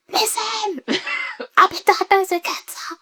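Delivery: high, half-laughing blurt; mock nervous blurting to husband; blurted news. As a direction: spill the news nervously, all at once. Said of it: "I was like".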